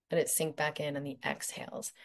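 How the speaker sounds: soft whispered exhale cue; soft spa whisper. Acted. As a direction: whisper it as you breathe out.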